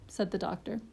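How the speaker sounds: ordinary delivery, nothing marked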